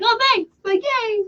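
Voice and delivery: high, sing-song thanks; chirpy mock thanks. Answering a fan with high bubbly thanks. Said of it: "so just like".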